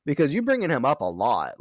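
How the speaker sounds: high, sassy mock-suspicious voice; voicing Anya as a sassy gossip. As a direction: pitch it up and get nosy, like a gossiping friend. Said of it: "she's a little".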